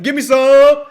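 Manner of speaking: high, loud whoop; over-the-top hyped yell; excited. Shown as a demonstration. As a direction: burst out with huge excitement. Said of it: "Can you sound excited?".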